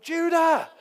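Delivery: loud, high shout across the house; urgent high call from the house. Retold or imitated. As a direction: yell for the other son to come. Dad calls son to see injury.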